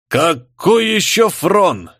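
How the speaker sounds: high, drawn-out indignant question; exasperated outburst. A narrator's reading. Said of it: "Не выдержал великий фюрер".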